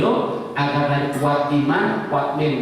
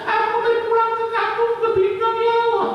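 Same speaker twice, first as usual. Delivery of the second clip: much higher, a wailing moan of the dead; imitated moaning of the dead in the grave, much higher; wailing plea. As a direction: cry out high like a soul begging to go home. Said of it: "nangis, merintih mereka".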